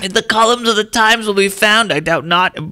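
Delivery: put-on high, old-timey reading voice. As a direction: read like a frail old man. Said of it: "old-timey voice".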